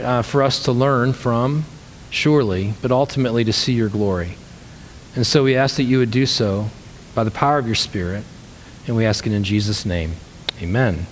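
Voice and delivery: low, hushed opening prayer to God; a pastor's gentle opening prayer to the congregation. Acted. Pastor prays before the sermon.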